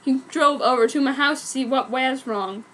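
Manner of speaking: plain reading voice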